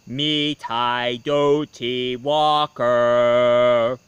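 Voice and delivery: slow, drawn-out spooky fireplace voice; eerie slow chant from the fireplace. A narrator's reading. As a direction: moan it out like a ghost from the chimney. Fireplace voice repeats.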